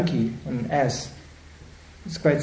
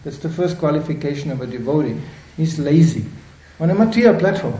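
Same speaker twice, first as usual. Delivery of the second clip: normal talking voice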